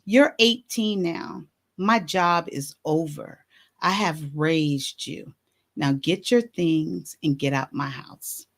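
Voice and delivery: slow, low, quiet retelling; very calm. Retold or imitated. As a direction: deliver it evenly, spaced out and unhurried. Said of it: "very calmly".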